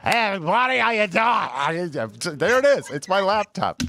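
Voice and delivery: high-pitched mock TV host voice; Jeff impression. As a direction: ham up a TV host greeting. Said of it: "was that your Jeff impression".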